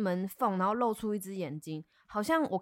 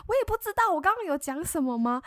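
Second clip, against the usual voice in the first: much higher, louder, incredulous; baffled; 9 semitones higher, 6 dB louder than usual. Retold or imitated. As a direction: act totally bewildered, voice up high. Retold baffled reply, big pitch jump.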